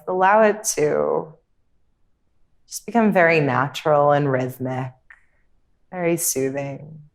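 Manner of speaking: calm, unhurried meditation voice with long pauses; guided meditation. Real, not acted. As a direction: guide them gently inward, leaving space between phrases.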